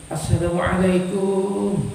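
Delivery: soft, slow singsong greeting; arriving wife. Acted. Wife greeting the doctor on arrival, singsong. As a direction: draw the greeting out gently.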